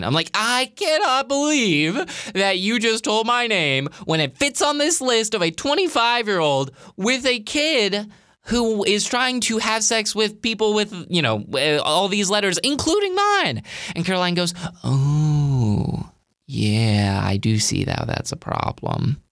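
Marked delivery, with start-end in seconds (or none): 0.3-13.6 high-pitched, incredulous retold rant; exasperated complaint, climbing higher at the end; frustrated. Speaker confronting Caroline in the driveway, frustrated and incredulous. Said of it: "I was so frustrated". 14.8-19.2 drawn-out oh, then dropping low; sheepish realization. Retold or imitated. Caroline admitting the slip to her friend, sheepish.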